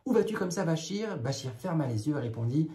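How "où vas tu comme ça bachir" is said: raised, higher mock shout; a touch of a crowd of market women yelling; shouting witches. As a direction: call out at the boy to stop him. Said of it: "se mirent à crier".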